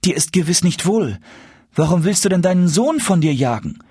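high-voiced, bewildered appeal; distressed pleading. A narrator's reading. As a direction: plead with the mother who rejects you. Rejected son pleads with mother. (flehend, mit hoher Stimme)

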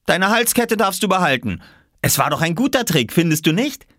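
put-on high-pitched boy's voice; high, eager little-boy voice; boy character, pleased with his trick. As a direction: chatter on as a pleased child. Boy figure in story.

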